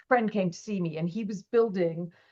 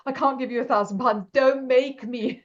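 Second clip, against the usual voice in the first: mock pleading; 5 semitones higher, 5 dB louder than usual. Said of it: "I was in tears".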